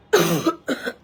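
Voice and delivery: a sudden loud dry cough; real cough. Real, not acted. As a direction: cough hard, as if you have a cold. Coughs mid sentence while sick. (জোরে কাশি)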